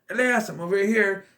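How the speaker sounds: half-strength retold yell; retold loud call. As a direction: imitate calling out to a kid who can't hear. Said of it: "Very loud".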